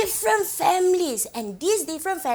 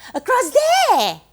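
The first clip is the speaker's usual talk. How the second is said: "there" flung out high and loud; calling out; 7 semitones higher, 8 dB louder than usual. Acted. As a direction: call "across there" to a far-off point, punching "there". Pointing far over the sea.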